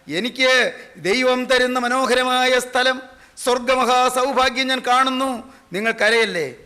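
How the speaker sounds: dying man's vision retold in ringing oratory; rapturous preaching quote. Preacher as dying Moody describing heaven to family, rapturous declaiming. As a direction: declaim each wonder with sustained, rising fervour.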